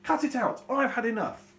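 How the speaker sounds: put-on dad shouting at the arguing kids; angry shout. Shown as a demonstration. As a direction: yell it like a dad at his limit. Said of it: "even more angry".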